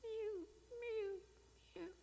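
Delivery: high, soft little mews; mewing lion cub. Acted. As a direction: mew like a lost baby cat. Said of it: "they heard this mewing".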